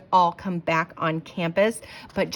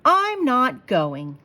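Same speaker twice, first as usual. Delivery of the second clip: slow, loud, higher sulky no; stubborn refusal; 6 semitones higher, 8 dB louder, much slower than usual. Acted. Sarah refusing school from under covers, sulky. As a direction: drag it out like a sulking child. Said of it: "pulled the covers over her head".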